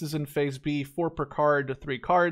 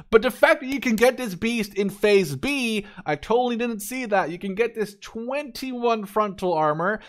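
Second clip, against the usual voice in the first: excited; 5 semitones higher, 3 dB louder than usual. Real, not acted. Thrilled discovering a strong tank.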